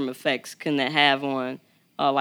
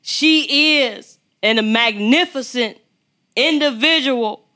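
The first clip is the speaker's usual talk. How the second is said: rising, louder announcer-style build; hype introduction; 6 semitones higher, 4 dB louder than usual. Acted. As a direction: talk her up like a big stage intro. Hyping up the guest's introduction.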